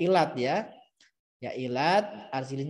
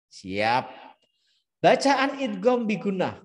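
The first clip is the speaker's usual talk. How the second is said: usual speaking voice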